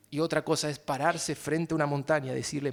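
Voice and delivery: ordinary delivery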